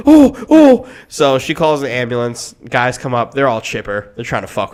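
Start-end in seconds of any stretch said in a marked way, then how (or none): 0.1-0.9 high, loud startled oh; shocked gasp. Retold or imitated. Girlfriend seeing the floppy leg, high startled gasp. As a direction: imitate her shock at the leg.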